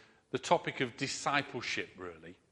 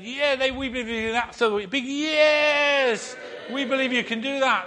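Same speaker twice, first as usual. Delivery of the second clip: higher, louder excited yell; revved-up crowd cheering yes; 9 semitones higher, 6 dB louder than usual. Retold or imitated. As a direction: shout it like a hyped crowd. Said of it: "they cheered and said".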